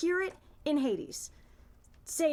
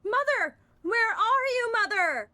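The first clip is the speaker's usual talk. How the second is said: crying out; 7 semitones higher, 6 dB louder, slower than usual. Acted. Persephone cries for mother.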